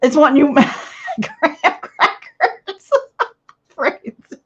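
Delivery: slowed, laughing through the words; giggling at a viewer's funny comment. Real, not acted. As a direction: read the comment while cracking up. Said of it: "that is so funny".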